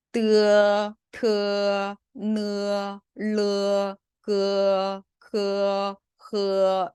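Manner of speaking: 뜨, 트, 느, 르, 그, 그, 흐 each held level, opening into 어; eu-to-eo glide. Shown as a demonstration. Teacher models syllables one by one, long gliding 뜨, 트, 느, 르, 그, 그, 흐. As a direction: open slowly from 으 to 어 on 뜨, 트, 느, 르, 그, 그, 흐. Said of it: "입을 천천히 벌려주면서 하는 발음이에요".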